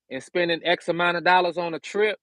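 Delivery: ordinary talk, nothing marked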